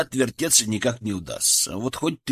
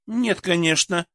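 scoffing; 6 semitones higher than usual. Acted. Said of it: "фыркнула девушка".

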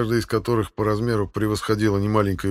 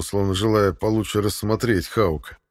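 usual speaking voice

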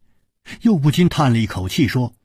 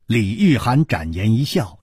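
usual speaking voice

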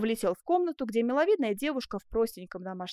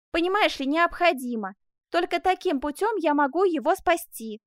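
higher, louder pleading explanation; urgent insistence; 6 semitones higher, 5 dB louder than usual. Acted. Man explains his rescue plan to sweetheart, urgent. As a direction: press the necessity urgently. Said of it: "воскликнул Жак".

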